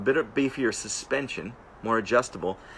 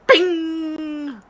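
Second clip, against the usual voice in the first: high, loud ringing "bing"; mimicked snap noise; 7 dB louder than usual. The rider imitates his clutch cable snapping, high loud ping. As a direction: sing out a high, drawn-out ping.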